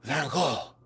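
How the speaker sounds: Gogh as a hushed, rounded "go"; affected alternative pronunciation. Shown as a demonstration. Seller offering a supposedly better version, softly affected. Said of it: "Is that better? Instead of".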